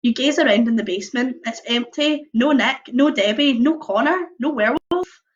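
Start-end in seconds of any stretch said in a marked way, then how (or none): none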